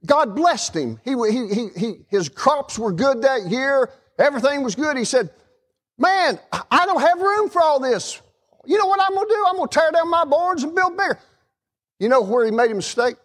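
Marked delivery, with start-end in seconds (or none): 6.0-11.2 high-pitched excitement at his own plenty; excited rich man. Retold or imitated. Preacher voicing the rich man with overflowing barns, excited.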